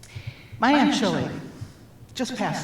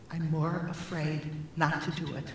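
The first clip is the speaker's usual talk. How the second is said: a soft first take, barely above a breath; 4 semitones lower, 9 dB quieter than usual. Retold or imitated. To the audience, sister's words quietly before repeating them.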